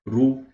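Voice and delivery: "ru" said short and clear with a rounded u; syllable with dhamma. Shown as a demonstration. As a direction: model "ru" with a rounded u. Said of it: "With the dhamma".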